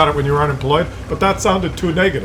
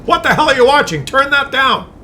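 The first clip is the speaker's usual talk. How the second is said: higher mock scold of an annoyed wife; 9 semitones higher, 3 dB louder than usual. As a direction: call out like a wife irritated from the next room. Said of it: "Your wife is probably in the other room going".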